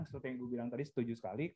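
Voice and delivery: ordinary talk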